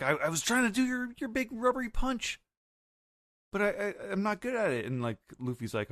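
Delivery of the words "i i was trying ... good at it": put-on very high, fast little-girl voice; sheepish Bonnie. Imitating Bonnie admitting she's bad at the punch, high and squeaky. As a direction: squeak it out, embarrassed she can't do it. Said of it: "she's like".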